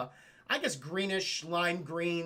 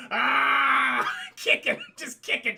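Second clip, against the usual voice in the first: breaking into laughter while describing; real giggling shout; laughing; 5 semitones higher, 7 dB louder, slower than usual. Real, not acted.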